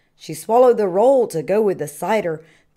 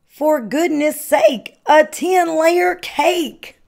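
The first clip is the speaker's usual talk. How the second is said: much higher, louder, very slow; astonished cry; 9 semitones higher, 5 dB louder, slower than usual. A narrator's reading. Reading to children, a high, slow, astonished cry. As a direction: stretch the astonishment out big. Exclaiming over the cake.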